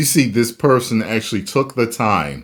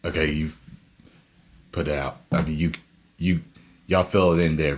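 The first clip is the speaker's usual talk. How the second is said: ordinary delivery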